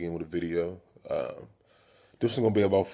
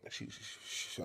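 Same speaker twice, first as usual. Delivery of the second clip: whispered, stammering start; acted frightened whispered stutter; scared stutter; 17 dB quieter than usual. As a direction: stammer under your breath, too scared to finish. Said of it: "I start stuttering and shit".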